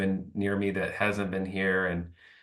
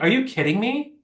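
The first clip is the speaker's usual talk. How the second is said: mock outraged disbelief; incredulous; 12 semitones higher than usual. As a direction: let the disbelief shoot up high. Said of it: "I was like".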